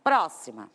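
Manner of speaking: Próxima, leaning on the opening PRÓ; stress on first syllable. Shown as a demonstration. Teacher shows class the strong syllable, stressing PRÓ in Próxima. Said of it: "o que eu tenho de sílaba mais forte".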